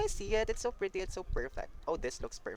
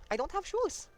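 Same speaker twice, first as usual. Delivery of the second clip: high alarmed cry, caught short; oh no, no shoes; panicked squeal; 4 dB louder than usual. Real, not acted. Realizing outfit lacks shoes.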